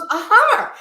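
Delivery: high, loud and thrilled, naming his dream car; excited. Retold or imitated. Husband telling his wife the car he wants, excited. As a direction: announce it like a kid with a new toy.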